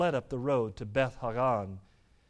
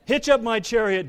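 loud, higher-pitched royal command; ordering; 7 semitones higher, 10 dB louder than usual. A narrator's reading. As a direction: give the order at full volume. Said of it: "Joram ordered".

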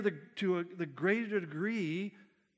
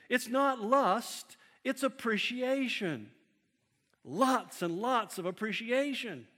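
slow, higher, sly protest; mock innocent excuse; 5 semitones higher than usual. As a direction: play the wink of the self-justifier, drawn out. Mocking a self-excuse.

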